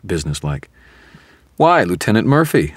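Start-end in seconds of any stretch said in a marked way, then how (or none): 1.6-2.7 high, loud, syrupy mock delight; saccharine gushing. A narrator's reading. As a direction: gush with exaggerated sweetness. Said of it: "I gushed, overflowing with saccharin".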